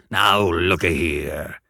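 slow, low convict voice; gruff convict. Acted. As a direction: drawl it menacingly. Convict speaks.

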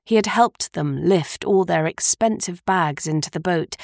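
usual speaking voice

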